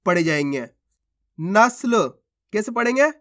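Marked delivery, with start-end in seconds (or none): 1.4-2.1 नस्ल with स joined straight to ल; no vowel between स and ल in नस्ल; half-letter pronunciation. Shown as a demonstration. Teacher reads the word for learners, नस्ल with half स. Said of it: "किस प्रकार से ये शब्द पढ़े जाएंगे".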